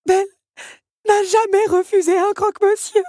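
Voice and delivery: sobbing teapot, acted. A narrator's reading. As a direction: wail it as if in tears. Teapot weeping.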